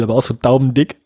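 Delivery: normal talking voice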